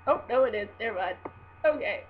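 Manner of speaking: ordinary delivery, nothing marked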